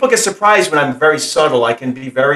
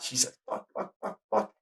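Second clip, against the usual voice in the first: whispered, much softer string of swears; whispered swearing; 18 dB quieter than usual. Retold or imitated. Son imitates his mother's swearing in a whisper. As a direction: breathe the swears under your voice. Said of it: "She says fuck a lot".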